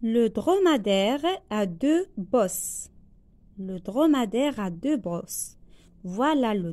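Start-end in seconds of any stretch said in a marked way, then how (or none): 0.0-2.9 slow, word by word dictation; spaced classroom dictation. Shown as a demonstration. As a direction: read it out slowly for pupils to write. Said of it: "teacher dictates slowly".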